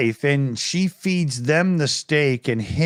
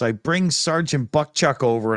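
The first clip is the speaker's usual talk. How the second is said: normal talking voice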